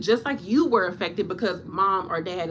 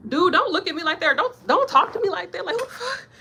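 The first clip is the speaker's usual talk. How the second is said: loud, higher, fed-up snapping; angry nurse; 9 semitones higher, 5 dB louder than usual. Retold or imitated. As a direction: tell the creepy old man off. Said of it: "You be like".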